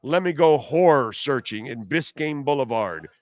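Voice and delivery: ordinary talk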